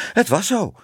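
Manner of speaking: high, panting baker's wife; hijgend. A narrator's reading. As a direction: pant it out in alarm. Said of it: "hijgde ze". (hoog en hijgend)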